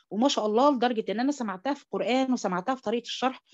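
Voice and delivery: ordinary talk, nothing marked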